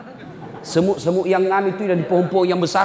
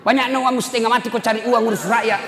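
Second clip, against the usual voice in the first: higher, fast mock scolding; wife scolding her camat husband; angry wife; 9 semitones higher, faster than usual. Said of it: "Langsung datang mamanya".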